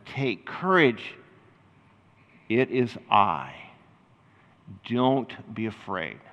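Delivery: slow, low and soft; steady frightened friends, unhurried and gentle; calm reassurance. Retold or imitated. Jesus calms the frightened disciples.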